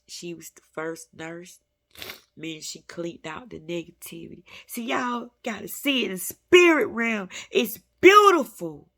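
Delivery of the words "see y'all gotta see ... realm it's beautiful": higher, much louder, rising into joy; joyful exclamation; ecstatic preaching. Real, not acted. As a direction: burst out in spiritual wonder. Preaching rapture.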